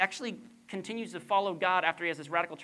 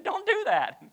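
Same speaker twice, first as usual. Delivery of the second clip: higher, whiny mock complaint; mock protest; 13 semitones higher, 5 dB louder than usual. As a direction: protest like a sulky kid. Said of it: "I said".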